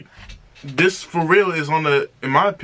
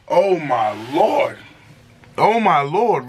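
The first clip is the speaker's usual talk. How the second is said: normal talking voice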